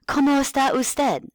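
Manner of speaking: high, bright singsong teacher voice; kindergarten teacher voice. Shown as a demonstration. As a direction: model the phrase for a small child. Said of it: "talk to them like you're a kindergarten teacher".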